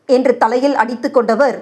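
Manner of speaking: plain reading voice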